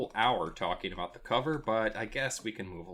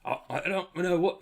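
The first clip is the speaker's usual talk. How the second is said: a hint of a British accent, higher and almost abandoned; half-started British accent; 9 semitones higher than usual. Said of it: "Sounded like you were".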